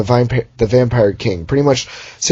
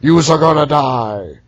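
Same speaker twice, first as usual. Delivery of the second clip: mock menacing wave; 4 semitones higher, slower than usual. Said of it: "a wave is like".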